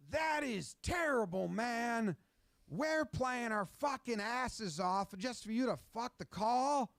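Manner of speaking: voiced quarterback cursing out the refs; hammed-up furious rant. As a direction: rage at the ref, drawing the words out. Voiced Mahomes raging at the call.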